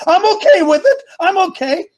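excited defiance. Real, not acted. Preacher defies fear, laughing.

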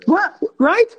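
normal talking voice

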